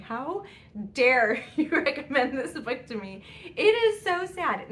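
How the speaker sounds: hammed-up wail of betrayal at the husband; mock tearful outrage. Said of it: "called my husband crying and saying".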